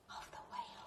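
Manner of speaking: breathy storytelling whisper; soft whisper ending the verse. A narrator's reading. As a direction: hush it to a whisper. Whispered line end.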